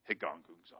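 Higongunzon said low and grumbly; grumbling Greek word. Shown as a demonstration. Congregation repeating the Greek word together, grumbling. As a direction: mutter Higongunzon like a complaint. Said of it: "That grumbling that gets in there".

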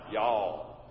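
drawn-out Southern y'all; a relaxed Southern "y'all". Shown as a demonstration. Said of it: "say it with me".

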